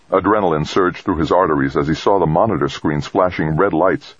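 ordinary delivery

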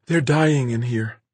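high, slow, sorrowful murmur; almost mournful. Acted. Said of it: "said almost mournfully".

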